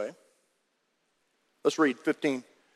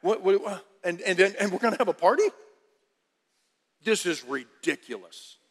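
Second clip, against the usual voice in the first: mock angry brother. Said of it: "he's angry and he's like".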